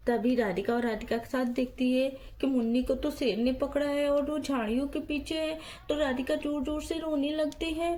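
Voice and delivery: low, soft storyteller voice; story narration. A narrator's reading. Narrator telling a child's doll story, low and soft. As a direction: narrate the scene calmly and low.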